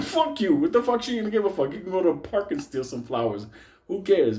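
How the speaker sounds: high-pitched, acted indignation; exasperated retort. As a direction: fire back at a friend's silly question. Retold argument with Mike about flowers.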